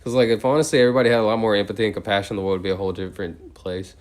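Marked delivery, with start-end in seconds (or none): none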